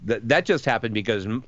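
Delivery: ordinary talk, nothing marked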